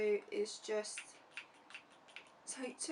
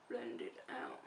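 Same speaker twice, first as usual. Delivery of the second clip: whisper; 14 semitones lower, 5 dB quieter than usual. Real, not acted. Absorbed in blending foundation.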